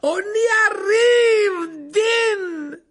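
loud, high, long-held shout; cried out. A narrator's reading. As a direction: holler encouragement and the next name. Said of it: "s'écria Lupin". (cri d'encouragement fort et étiré)

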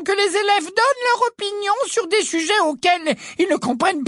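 very high, syrupy and prim; a wicked stepmother's sweetness; sugary voice. A narrator's reading. Ombrage lecture, same voice. (voix doucereuse)

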